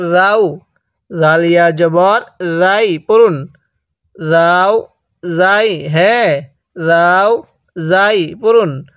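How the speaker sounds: ordinary delivery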